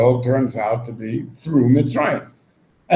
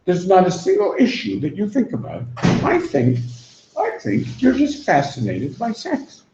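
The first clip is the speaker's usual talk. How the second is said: retorting Gingrich; 8 semitones higher than usual. Retold or imitated. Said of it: "he says".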